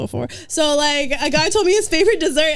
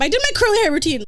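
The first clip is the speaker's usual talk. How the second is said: normal talking voice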